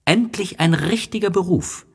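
put-on high, eager child voice; little prince. A narrator's reading. Little prince praises the geographer's job, high and eager. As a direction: sound like a delighted boy. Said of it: "sagte der kleine Prinz".

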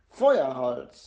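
loud, angry burst; outraged child's outburst; furious. A narrator's reading. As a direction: throw the word out in indignant fury. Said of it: "sagt Jana wütend".

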